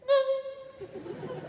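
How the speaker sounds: very high squeaky mock sob; tearful mock reply. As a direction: squeak it out like a crying girl. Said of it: "Why are you crying?".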